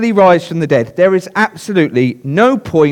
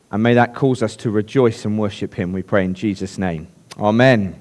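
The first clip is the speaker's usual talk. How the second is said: prayer; 4 semitones lower than usual. Acted. Pastor praying for the congregation, hushed and reverent. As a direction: pray gently to the Father before preaching. Pastor prays before the sermon.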